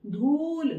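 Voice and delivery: धूल with a long, drawn-out ऊ. Shown as a demonstration. Teacher showing pupils the ऊ vowel, धूल with long ऊ. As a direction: hold the ऊ in धूल long and firm. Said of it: "ऊ की मात्रा को लगाने के लिए".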